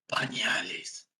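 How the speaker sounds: low, slow, unvoiced tomb voice; voice from beyond the grave. Desperate father in the pharmacy, voice from beyond the grave. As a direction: drag it out like a ghost from the crypt. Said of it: "Con una voz de ultratumba".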